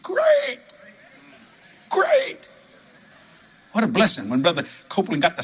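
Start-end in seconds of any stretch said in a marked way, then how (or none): 0.0-0.6 much higher, falling mock disappointment; mock sulky great. Mock sulking about bigger plane. 1.9-2.5 much higher, rising sarcastic twist; mock sarcastic great. Mock sulking about bigger plane.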